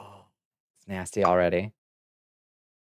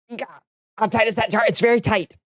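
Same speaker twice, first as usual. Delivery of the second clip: high voice, straining on lid; high strained voice; 10 semitones higher than usual. Real, not acted. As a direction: squeeze the words out high. Straining on the jar.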